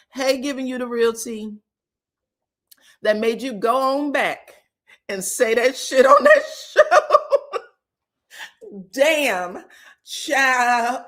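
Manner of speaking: normal talking voice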